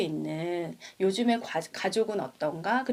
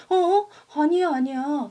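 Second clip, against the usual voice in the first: much higher, louder startled cries; 7 semitones higher, 7 dB louder than usual. Retold or imitated. Willy startled after bumping into Hugh, surprised protest. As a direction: jump in surprise, then protest. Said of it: "윌리가 깜짝 놀란 거예요".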